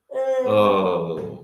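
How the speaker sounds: ordinary delivery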